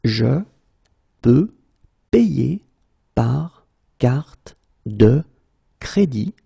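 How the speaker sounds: par carte de stretched very slowly; slow, broken into words. Shown as a demonstration. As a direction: pause between every word. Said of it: "teacher drills word by word".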